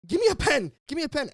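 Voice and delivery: loud, high, excited demand; excited yell. Retold or imitated. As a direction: shout it like an eager fan. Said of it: "he's like".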